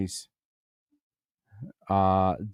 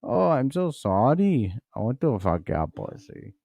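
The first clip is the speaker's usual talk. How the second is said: put-on Indian or Chinese accent. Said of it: "waking up being Indian or Chinese".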